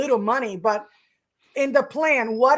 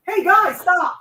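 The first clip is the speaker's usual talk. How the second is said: loud, high call at the dogs; 7 semitones higher, 6 dB louder, slower than usual. Real, not acted. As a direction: snap at the dogs to stop. Scolding dogs in the room.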